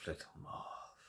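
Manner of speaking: soft breathy hush; calm him with a whisper; whispered soothing. Acted. Cohen calming the visitor, soft whisper.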